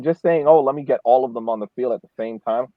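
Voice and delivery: ordinary delivery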